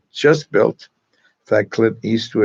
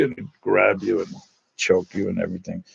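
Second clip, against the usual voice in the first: normal talking voice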